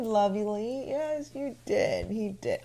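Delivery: teasing fake agreement, slow and soft; sarcastic. Real, not acted. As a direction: humour them with quiet, drawn-out sarcasm. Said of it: "I'm being sarcastic".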